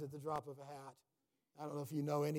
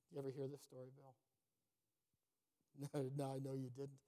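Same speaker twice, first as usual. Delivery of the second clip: usual speaking voice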